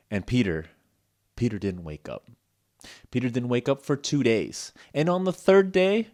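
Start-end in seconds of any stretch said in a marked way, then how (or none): none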